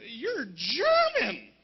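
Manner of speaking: hammed-up "are you serious" retort; incredulous comeback. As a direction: stretch it out, dumbfounded. Incredulous comeback to the German woman.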